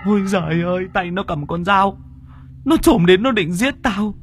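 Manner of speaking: very high, frantic panic; Tùng telling Đã about the killer doll in terror; terrified. Acted. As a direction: gasp it out like a hunted man. Friend recounts the doll ghost.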